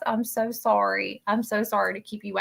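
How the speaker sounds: usual speaking voice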